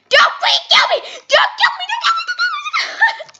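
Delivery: very high, loud, frantic shrieking; half-laughing terror as the killer closes in; panicked pleading. Real, not acted. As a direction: beg for your life, laughing in panic. Being attacked in the game.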